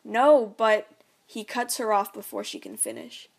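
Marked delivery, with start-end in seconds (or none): 0.0-0.9 louder insistent no; urgent protest. Acted. Mom protests before dad cuts her off, urgent. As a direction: push back hard. Mom cut off mid argument.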